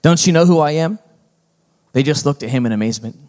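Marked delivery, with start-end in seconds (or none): 0.0-1.0 louder, higher indignant question; cross. A narrator's reading. Donkey demanding the crowd know him, crossly. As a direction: demand to be recognized. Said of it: "he said crossly".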